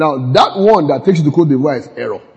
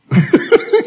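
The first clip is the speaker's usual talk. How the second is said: high burst of laughter. Real, not acted. Laughs at pastor's note.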